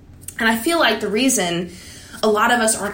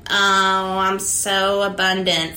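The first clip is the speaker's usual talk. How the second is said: sarcastic affirmation; slower than usual. Retold or imitated. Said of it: "I'm like".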